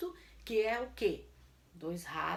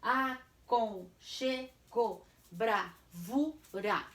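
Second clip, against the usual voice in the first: "Aconchego, bravura" slow, in separate syllables; much slower than usual. Shown as a demonstration. Teacher ends the review, "Aconchego, bravura" syllable by syllable. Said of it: "eu vou separar".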